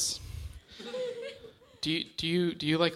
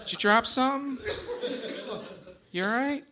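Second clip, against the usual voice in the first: mock concern; 10 semitones higher than usual. Real, not acted. Addressing fan who dropped something.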